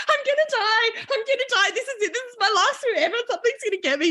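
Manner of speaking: high, laughing wail of doom; dramatic girl sure she'll die, high and laughing; mock panic. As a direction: wail it like a melodramatic teen. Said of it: "And Jane's like".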